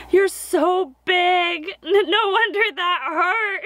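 high, slow, laughing and teary; overwhelmed joy, half laugh half cry; tearful laugh at the memory; laughing through tears. Real, not acted. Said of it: "I'm gonna try not to cry".

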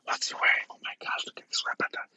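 hushed onlookers' whispering; gossiping whisper. Retold or imitated. Restaurant diners remarking on dad's pajamas, whispered.

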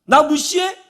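high, loud mock challenge; acted angry retort. Preacher showing how people snap back, indignant retort. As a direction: fire back as if insulted. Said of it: "말을 받아치거나".

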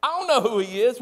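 loud, high, defensive denial; panicked denial. Retold or imitated. Peter denying Jesus to the accuser, mock loud panic. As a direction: deny it fast and loud, rattled. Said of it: "Peter's like".

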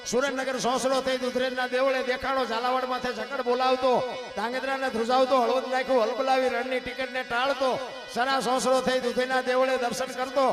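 loud, rhythmic chanted recital of a ballad; fiery dayro-style recitation of a village poem; folk poem recitation. Acted. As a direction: declaim each alliterative line in a driving rhythm, rising to a shout at the end. Recites Bhikhabhai Rabari's earthquake poem.

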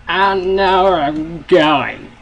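high, slow, drawn-out holler; loud proclaiming. Pretend. Player announcing he knows his way, loud drawn-out holler. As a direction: belt it out slowly in a high voice. Mock bravado after warning of screaming.

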